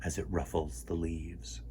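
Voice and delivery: put-on lively storytime read for kids; reading a picture book aloud. A narrator's reading. Host reading a word book to children, lively storytime voice.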